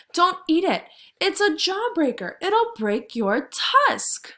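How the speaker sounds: high, loud little mouse warning; a tiny mouse pleading urgently; squeaky mouse. Acted. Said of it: "squeaked Mister Mouse".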